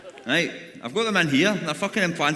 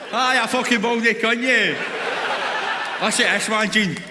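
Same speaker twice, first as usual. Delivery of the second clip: louder and higher, jeering at the bald man; mock insult shout; 6 semitones higher, 4 dB louder than usual. Comedian roasts a bald heckler named Gene, jeering shout. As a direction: bellow it across the room.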